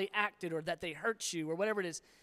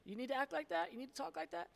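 high scolding wife voice; high, pointed scold from his wife; wife's mock scolding; 8 semitones higher, 3 dB quieter than usual. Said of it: "She'll be like".

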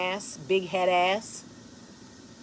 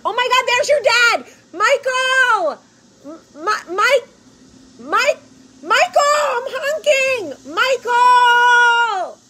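high, loud shout calling out Michael; excited shouting; 10 dB louder, slower than usual. Retold or imitated. Spotting her husband on the road, high loud shouting. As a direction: yell his name across the highway, honking. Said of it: "and I was like".

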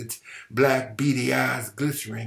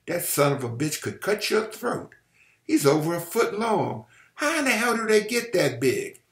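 higher-pitched amazement; awed admiration; 6 semitones higher than usual. A narrator's reading. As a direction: marvel at it, pitch climbing. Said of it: "tones of awed admiration".

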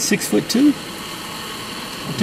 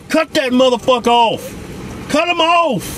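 urgent shout; 12 semitones higher, slower than usual. Real, not acted. Urging friend to cut off car.

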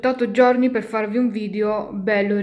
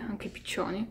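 whispered aside; 11 dB quieter than usual. Real, not acted. Unvoiced aside.

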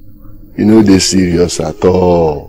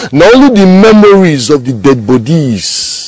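impassioned raised voice; emphatic argument; 9 semitones higher, 5 dB louder than usual. Acted. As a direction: stretch it out in a raised high voice. Arguing over the share.

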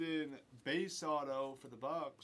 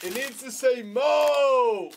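slow, loud shout across the room; 8 semitones higher, 16 dB louder, slower than usual. Real, not acted. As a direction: yell it out, drawn out. Yelling so the fixer hears.

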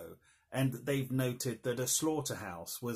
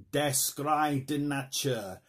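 slow, careful mock German accent; 3 semitones higher, 5 dB louder, slower than usual. Shown as a demonstration. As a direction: spell out Der Schrei der Natur in a German accent. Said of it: "That is not a German accent".

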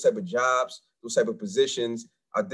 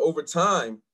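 ordinary talk

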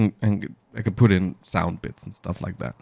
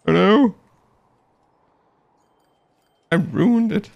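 mixer voice effect; 13 semitones higher, 3 dB louder than usual. Pretend. Mock panic over mixer effect.

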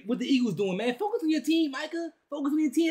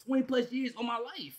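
ordinary talk, nothing marked

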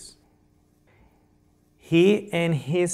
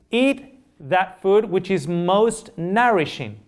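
raised, emphatic sermon voice; preaching; 5 semitones higher, 4 dB louder, slower than usual. Acted. Preacher driving home the principle of vegetarianism, emphatic. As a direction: proclaim it to the congregation.

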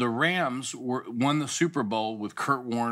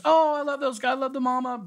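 bright, gushing high mom voice; imitating excited mom; 11 semitones higher, faster than usual. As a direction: gush like mom hearing good news. Mom excited about the players.

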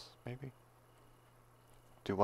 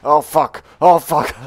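panicked; 10 semitones higher, 14 dB louder than usual. Real, not acted. Panicked mid fight.

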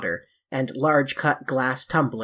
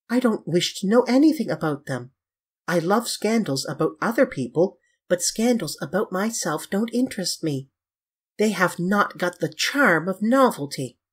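higher, airy voice for Dorian; dismissive Dorian; 5 semitones higher than usual. Acted. Dorian dismissing scandals about himself, airy. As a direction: wave it away lightly, pitched up.

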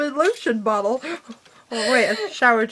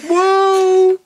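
amazed whoa; 5 semitones higher, 6 dB louder than usual. Retold or imitated. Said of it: "I was like".

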